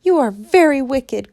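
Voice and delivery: loud high accusing cry; girl crying out at the witch; cried. Acted. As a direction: fling the accusation. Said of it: "cried Dorothy".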